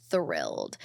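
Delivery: "Thrilled" dropped very low and soft; deep, low "Thrilled" as a joke; deep mock voice. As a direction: growl out "Thrilled" in a deep, quiet voice. Repeats the word much lower.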